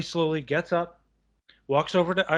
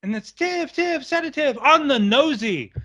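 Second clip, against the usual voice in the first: strangled charades; 11 semitones higher than usual. Retold or imitated. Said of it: "said a dirty word".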